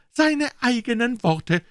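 high, slow triumphant emphasis; Aunt Polly triumphant. Acted. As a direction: savour each word in triumph. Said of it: "triumphierte Tante Polly".

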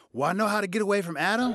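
high, fast eager burst; excited Eve. Retold or imitated. Eve to the serpent, eager and fast. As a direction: rush it out in sudden glee. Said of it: "Eve suddenly gets excited".

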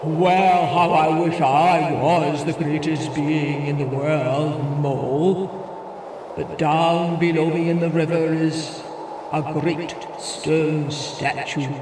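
humbled wind. Acted. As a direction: admit defeat with a sigh.

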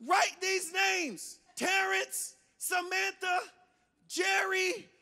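high, drawn-out call from another room; mock yell, pitched high, name by name; mom calling out. Mom calls names to us from her bedroom, high mock yell. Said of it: "she'd yell to us from her bedroom".

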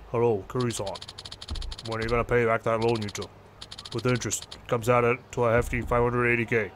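low-pitched debt collector; low, threatening phone caller; menacing loan shark. Acted. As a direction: lean on them for the money, pitched low.

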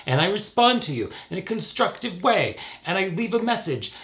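high-pitched, emphatic complaining; Raven. Real, not acted. Complaining about unanswered replies to commenters, high and emphatic. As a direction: pitch it up and spell out the grievance. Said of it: "starting to sound like Raven".

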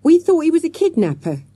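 put-on high, loud child defending herself; stoutly. A narrator's reading. Avril defending the children's tackle, stout high protest. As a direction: stand your ground, high and loud. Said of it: "said Avril stoutly".

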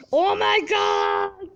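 slow, drawn-out shouted disbelief; stunned shout. Real, not acted. Gamer stunned by the play, shouting in disbelief. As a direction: let it burst out slowly. Third, climactic oh my god.

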